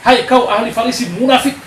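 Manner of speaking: high, loud condemning cry; stern rebuke. Acted. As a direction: denounce them as hypocrites. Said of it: "Dia tegas tentang pertobatan". (teguran keras dan lantang)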